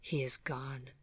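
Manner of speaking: softly whispered, defeated; grieving whisper to herself; hollow whisper. A narrator's reading. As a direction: breathe it out, barely there. Bleeding alone after he left.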